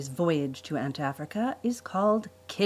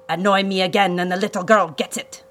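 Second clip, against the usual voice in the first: loud villain growl; growled threat; 2 semitones higher, 6 dB louder than usual. A narrator's reading. Count warns the children holding their sister, growling. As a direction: growl a warning, louder than usual. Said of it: "he growled".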